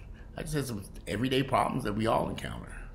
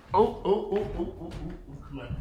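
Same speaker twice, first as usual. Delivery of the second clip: startled concern; 11 semitones higher than usual. Real, not acted. Noticing sick dog.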